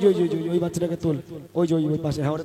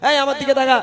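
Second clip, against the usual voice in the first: calling out; 8 semitones higher, 6 dB louder than usual. Real, not acted. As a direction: call him to look up, loudly. Host calling shy child to look.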